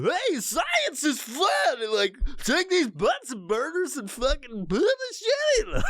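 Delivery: high, slow, goofy science-show host voice; dorky scientist. Retold or imitated. Mocking the science video host for his son. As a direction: ham up a manic kids' TV scientist. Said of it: "weird, uh, dorky scientist".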